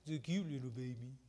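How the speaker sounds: put-on soft cooing adult baby talk to an infant; a light, soft baby-talk voice. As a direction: coo it like you're talking to a baby. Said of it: "adults start talking like children".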